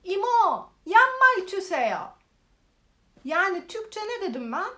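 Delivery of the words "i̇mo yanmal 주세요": calling a restaurant server across the room. Retold or imitated. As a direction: shout across the restaurant, higher and louder. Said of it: "şimdi ben bağırıyorum".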